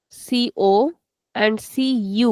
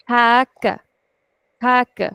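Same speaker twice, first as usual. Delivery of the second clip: long, stressed ha, then a short ka; stretched first sound. Shown as a demonstration. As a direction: demonstrate "ha, ka" leaning on the first syllable. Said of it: "I'm stretching the first sound".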